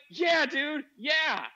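high, loud acted affirmation; emphatic enthusiasm. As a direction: confirm it with hyped-up zeal. Acting own emphatic reply.

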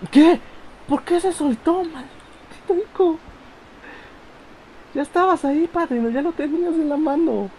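high, loud exasperation; outraged shout. Real, not acted. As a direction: yell at the game in disbelief. Character lets go, outrage.

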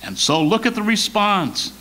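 ordinary talk

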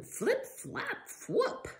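high bouncy flip flap whoop; playful sound words. Acted. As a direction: make the belt noises fun.

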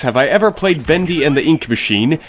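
normal talking voice

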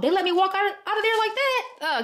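can't believe they let her walk out; surprised disbelief. Real, not acted. Telling viewers, stunned the store let her leave. Shock at unremoved security tag.

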